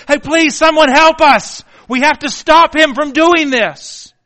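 desperate shout for someone to help; desperate cry for help. Acted. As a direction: call out to stop him in a panic. Family crying out for help.

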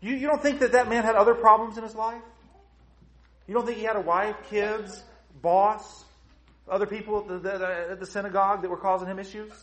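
loud, high-pitched challenging questions; forceful preaching. Acted.

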